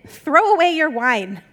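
loud, slow command; stern demand. A narrator's reading. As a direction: order it loudly, word by word. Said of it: "he demanded".

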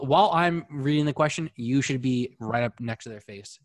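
ordinary delivery

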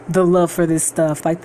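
usual speaking voice

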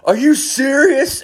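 high, drawn-out mock yell; stretched, high-pitched retold holler; imitated distant yelling. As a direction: mimic outraged players yelling back. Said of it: "you would hear people yelling back".

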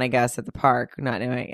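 ordinary talk, nothing marked

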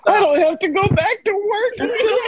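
very high, squealing mock shout; hammed-up fake happiness. Dad tells family about work while tense, shrieked mock cheer. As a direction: imitate a dad's over-the-top happy yell. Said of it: "they're trying to act happy".

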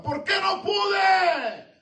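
high, very slow pleading shout; anguished shout. Acted. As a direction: drag out the question in anguish. Preacher voicing the disciple. (grito suplicante, agudo y muy lento)

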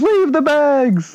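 high, loud, very slow drawn-out order; slow mock shout. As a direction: stretch every word out as a loud shout. Repeating the urgent order.

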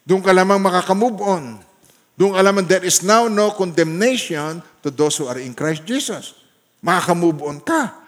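loud, fervent preaching; preaching at full force. Acted. Preacher to congregation, loud fervent proclamation.

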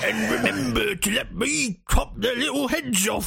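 high, drawn-out mock-Cockney; eager Cockney henchman, hammed right up; Cockney geezer orc. Cockney orc sidekick pestering his master, hammed-up eagerness. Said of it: "we got the Cockney geezer orc".

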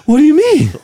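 high, breathless movie-star impression; puzzled question, acting out of breath; Mark Wahlberg. Host showing off a Wahlberg bit, acting out of breath. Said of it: "have to act out of breath".

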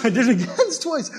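embarrassed laugh mid-sermon. Real, not acted. Laughs at own slip.

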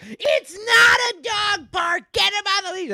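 imitating the park lady yelling; old lady shouting. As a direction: shriek like an outraged old woman.

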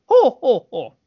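very high, loud hooting; gleeful taunting hoot; high, loud mocking whoops; villain's hoot. Satori's taunting hoot again.

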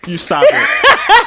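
high, slow, laughing plea to stop; laughing protest. Real, not acted. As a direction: beg him to stop through laughter.

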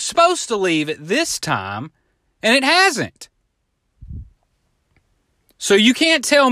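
rising exasperation at the runaround; exasperated complaint. Retold or imitated.

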